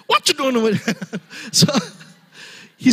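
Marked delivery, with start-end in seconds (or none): 0.1-0.9 high-pitched cartoon donkey impression; Shrek movie accent. Preacher joking with a bad movie impression, Shrek accent. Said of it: "the Shrek accent".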